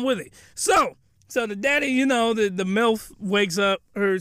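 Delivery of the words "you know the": usual speaking voice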